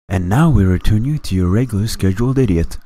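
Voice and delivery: lilting parody station announcement; mock broadcast announcer. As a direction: hand back to programming like an announcer.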